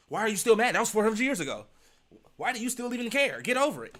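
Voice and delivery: high, loud, mocking a dismissive critic. Imitating people who tell him to get over it, high and loud.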